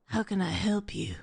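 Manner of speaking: breathy whisper. Retold or imitated. Mocking the male lead's line in a whisper. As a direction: whisper it like a romance lead. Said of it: "It's literally like that".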